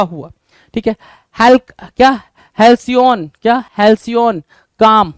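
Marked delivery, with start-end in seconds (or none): none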